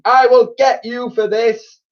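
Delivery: slow, high, loud threat; furious high-pitched vow of revenge; screamed threat. A narrator's reading. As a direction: scream it with vengeful force. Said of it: "she screamed".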